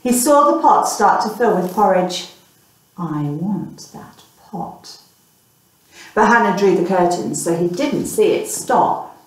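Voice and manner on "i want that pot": greedy murmur. Acted. Greedy boy wants pot.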